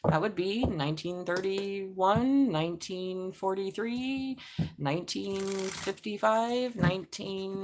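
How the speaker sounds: slow, careful reckoning of the years; slow counting. Real, not acted. Slowly working out the years.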